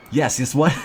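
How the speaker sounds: sudden startled outburst; surprised. Real, not acted. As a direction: caught off guard by the book. Reacting to book calling him out.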